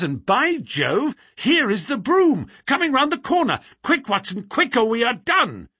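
high-pitched excited exclamation, building; urgent alarm. Acted. Holmes spotting the carriage to Watson, urgent and excited. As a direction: urge a companion to hurry before you're caught.

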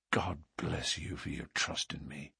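low, hushed blessing; tender gratitude. Acted. Suitor blesses sweetheart for her trust, quiet low tenderness.

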